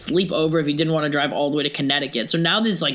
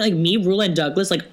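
ordinary delivery

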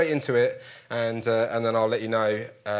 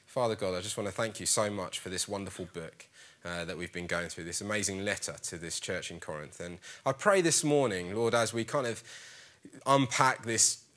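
prayer; 6 semitones lower, 9 dB quieter than usual. Acted. Opens a prayer.

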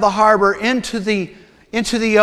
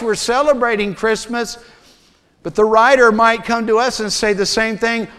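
ordinary talk

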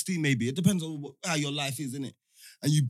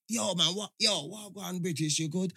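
put-on compressed Jamaican accent; 9 dB quieter than usual. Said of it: "trying to compress their Jamaican accent".

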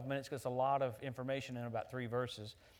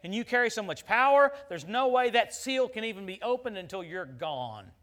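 loud, rising preacher's cry, then slower; full-force sermon peak; preaching climax; 6 semitones higher, 11 dB louder than usual. Acted. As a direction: hammer the power of the church home.

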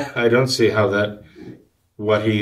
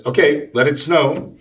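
lightly voiced cartoon boy, pitched up; higher, mock command to the sky; boy commanding snow; 6 semitones higher than usual. Retelling young Calvin's demand for snow on the porch, mock command.

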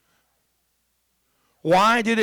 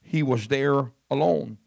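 scripture reading; 4 semitones lower than usual. Acted. Preacher reading the gospel to his congregation, measured and low. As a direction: read the passage to the church steadily.

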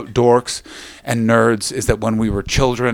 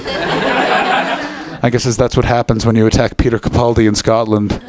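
usual speaking voice

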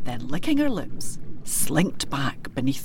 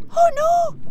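very high, louder squeaky cry; a tiny calf yelping in fright; wee coo crying out; 7 dB louder than usual. A narrator's reading. Wee coo cries out at the kelpie's threat, very high. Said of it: "Wee Hieland Coo cried".

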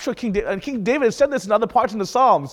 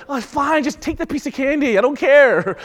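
high, louder, exasperated surrender; giving in to a child; 7 semitones higher, 3 dB louder than usual. Retold or imitated. Parent worn down by a crying child, exasperated giving in. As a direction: cave in to the kid's candy demand. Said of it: "So you're just sitting here like".

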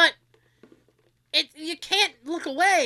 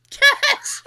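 laughing high, loud shout of catch; hammed-up loud playful call; mock shout; 13 semitones higher, 7 dB louder than usual. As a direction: yell it like tossing a globe.

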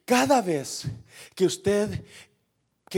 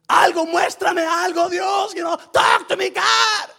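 high, insistent cry for a sign; shouted plea to God; 11 semitones higher, 5 dB louder than usual. Acted. Believer begging God for any sign, shouted plea. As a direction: beg God loudly, pitch climbing.

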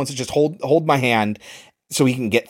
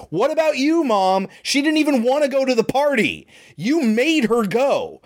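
higher, accusing retort from the son; son's angry retort; 10 semitones higher than usual. Retold or imitated. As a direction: throw the blame back at mom, voice pitched up. Son retorts in heated argument.

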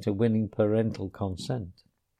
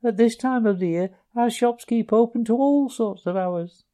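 higher, quick little voice; small Field Mouse voice; 11 semitones higher than usual. A narrator's reading. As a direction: explain eagerly to the Rat. Field Mouse explaining shops.

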